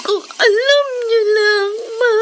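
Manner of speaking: tearful sobbing. Acted. Girl sobbing to her absent mother, crying. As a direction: voice breaking over the words.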